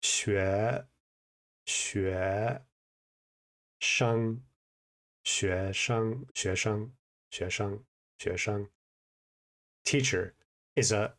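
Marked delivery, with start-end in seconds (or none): 0.0-8.7 学 rising, 生 flat, then 学生 repeated in a softening drill; teacher's tone drill. Shown as a demonstration. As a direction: drill 学生 syllable by syllable then whole. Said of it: "so you say".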